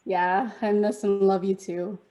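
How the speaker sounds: ordinary delivery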